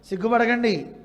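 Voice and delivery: loud, pointed jab at a shameless guest. Shown as a demonstration. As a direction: needle them for eating too much. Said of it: "అన్నా కూడా అర్థం అది మారిపోతుంది".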